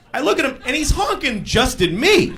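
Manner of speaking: ordinary talk, nothing marked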